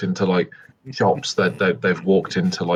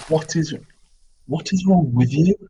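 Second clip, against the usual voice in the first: disgusted; 9 semitones higher than usual. Acted. Disgusted at his soiled companion.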